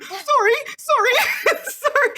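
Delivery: high, laughing sorry sorry; flustered rapid apologies; mock frazzled clerks; frazzled apologizing. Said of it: "bumping into each other".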